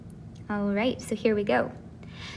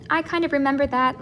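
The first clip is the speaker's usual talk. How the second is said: ordinary talk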